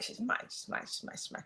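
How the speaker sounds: whispered she's mine, repeated; possessive whisper. Pretend. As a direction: hush it like a secret.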